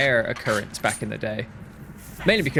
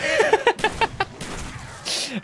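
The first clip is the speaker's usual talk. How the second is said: high breathy laugh. Real, not acted. Laughing at himself.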